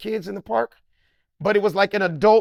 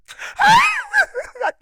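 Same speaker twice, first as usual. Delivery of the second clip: very high, loud sobbing shriek; hammed-up squealing sob; groupie crying wail; 6 dB louder than usual. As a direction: break into a fan's crying shriek. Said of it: "crying like a little groupie bitch".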